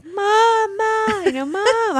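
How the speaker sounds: high-pitched, reenacted call to Mom; mock-helpless calling; retold cry for Mom. Calls Mom after the injury.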